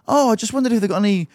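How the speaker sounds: high-pitched quote of a neighbour; neighbour's request retold. Neighbour at the gate asking for vanilla extract, high imitation. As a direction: imitate the caller, pitched well up.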